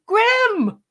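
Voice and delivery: high, delighted call of a name; excited greeting. Real, not acted. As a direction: hail a friend who just walked in. Welcoming a friend who just arrived.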